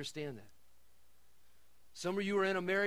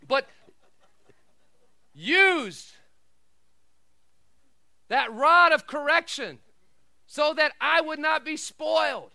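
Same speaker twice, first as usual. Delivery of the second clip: loud, raised preacher's delivery; emphatic pulpit delivery; full-force preaching; 6 semitones higher, 11 dB louder than usual. Real, not acted. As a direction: proclaim it high and loud, in separate bursts.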